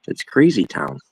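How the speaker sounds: ordinary delivery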